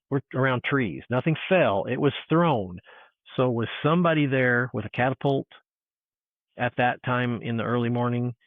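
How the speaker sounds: ordinary talk, nothing marked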